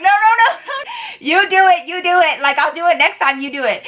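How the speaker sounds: high, squealed string of pleading protests; high-pitched mock panic, begging out; panicked refusal. Scared trainee begs coworker to open the champagne, panicked refusal.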